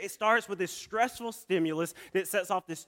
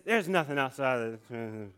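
sleepy brother; 5 semitones lower, 3 dB quieter than usual. Retold or imitated.

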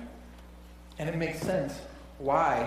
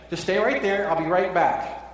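calling out; 4 semitones higher, 6 dB louder than usual. Retold or imitated. Parent calls to kid in car.

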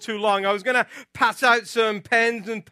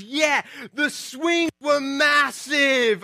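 put-on higher, drawn-out British kid voice; thick British accent; 5 semitones higher, much slower than usual. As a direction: imitate an excited little British boy. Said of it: "thick British accent".

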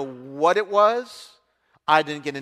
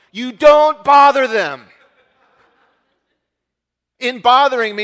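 loud, slow, and much higher, every word driven home; emphatic punchline; 6 semitones higher, 12 dB louder than usual. Real, not acted. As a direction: preach it like the point is obvious.

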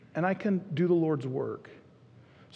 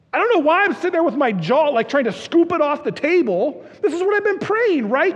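higher and louder, amazed; excited; 9 semitones higher, 6 dB louder than usual. Real, not acted. Pastor amazed that his prayer was answered, excited. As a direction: tell it like you still can't believe it. Recalling his jaw dropping.

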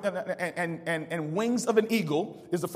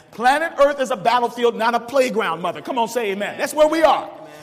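louder, raised preacher's voice; preaching; 5 semitones higher, 8 dB louder than usual. Acted. Pastor rousing the church, raised and loud. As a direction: drive the point home from the pulpit.

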